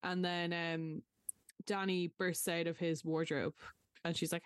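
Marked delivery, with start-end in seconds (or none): none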